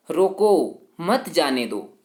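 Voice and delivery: रोको said loud on its own, then a break before मत जाने दो; pause after रोको. Shown as a demonstration. Teacher shows students a comma's effect, pause after रोको. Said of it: "अल्प विराम लगा है".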